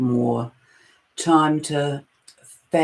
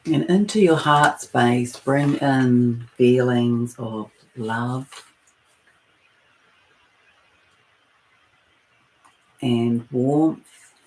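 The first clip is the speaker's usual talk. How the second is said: guided meditation; 2 semitones lower than usual. Acted. Leading listeners into their breath and heart space, guided meditation. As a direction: ease listeners inward, phrase by unhurried phrase.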